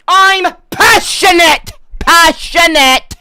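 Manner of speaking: high, loud, slow shout; drawn-out mock shout. Ginger streamer to chat, slow drawn-out comic shout. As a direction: stretch every word as you yell.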